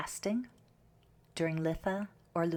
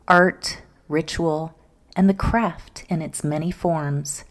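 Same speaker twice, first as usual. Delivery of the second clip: loud, projected show introduction; strong, emphatic host intro read; podcast intro announcer; 11 dB louder than usual. Acted. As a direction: announce the show with punch, phrase by phrase.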